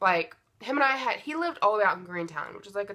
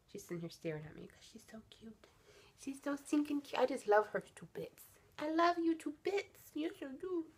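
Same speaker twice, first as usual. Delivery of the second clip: cooing pet voice; 7 semitones higher, 10 dB quieter than usual. Real, not acted. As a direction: coo at your puppy, high and soft. Cooing over the dog.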